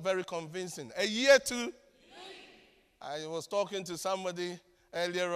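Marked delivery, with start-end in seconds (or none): 1.0-1.7 loud call, left open for an answer; rousing call for response. Real, not acted. Preacher prodding an unconvincing congregation, rousing call. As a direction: push the crowd to fill in the blank.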